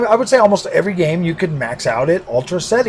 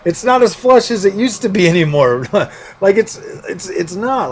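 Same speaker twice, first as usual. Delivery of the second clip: higher voice with chuckles breaking in; amused, laughing; 5 semitones higher than usual. Real, not acted. YouTuber admitting his wallet sponsors the video, laughing. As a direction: chuckle ruefully through the admission.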